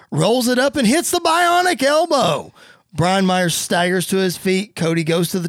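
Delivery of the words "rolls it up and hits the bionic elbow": rising play-by-play call; higher-pitched ringside excitement; excited move call. Acted. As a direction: build up to the big hit. Calls a big wrestling move.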